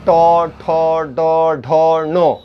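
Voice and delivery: टो ठो डो ढो नो, retroflex syllables one by one; tongue curled back for टो ठो डो ढो नो; sound demo. Shown as a demonstration. Teacher demonstrates retroflex syllables to class. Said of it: "तो कहाँ से बोलते हैं".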